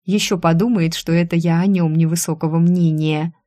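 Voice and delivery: plain reading voice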